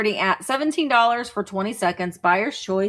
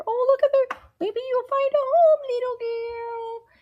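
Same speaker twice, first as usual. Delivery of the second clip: very high, sweet voice for the doll. Pretend. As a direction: coo to the little doll. Talking to a doll.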